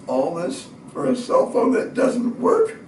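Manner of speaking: high, slow incredulous complaint; mock exasperation at the whole ordeal; exasperated disbelief. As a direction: draw it out in disbelief. Exasperated punchline.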